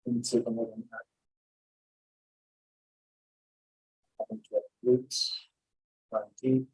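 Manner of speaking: soft, measured mantra with pauses between phrases; meditative mantra repetition. Shown as a demonstration. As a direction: repeat it quietly, letting each phrase settle.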